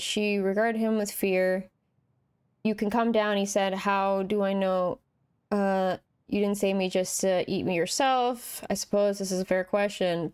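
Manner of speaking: reading a fairy tale aloud; lightly voiced storytelling; story narration. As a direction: read the tale to the listener. Reads game story aloud.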